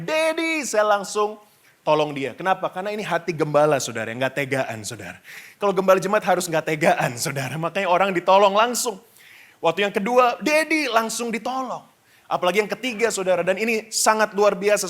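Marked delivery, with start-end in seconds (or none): 0.1-0.6 lightly acted kid calling for Dad; imitated child's cry. Child calls daddy for help in the pool, imitated high cry. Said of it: "Setiap anak saya teriak". 10.4-10.9 mock kid's call, pitched up; imitated child's cry. Second child calls daddy while learning to swim, imitated high cry. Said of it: "Setiap anak saya teriak".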